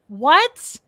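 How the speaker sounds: high, loud rising disbelief; mock-stunned question swooping up; retold shock. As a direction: act out being floored.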